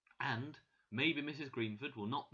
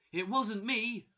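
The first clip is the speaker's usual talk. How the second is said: high, loud protest; shouting; 9 semitones higher, 8 dB louder, slower than usual. A narrator's reading. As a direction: shout your innocence. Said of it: "she shouted".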